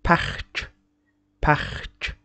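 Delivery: park with a hard final consonant; French parc. Shown as a demonstration. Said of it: "Don't say".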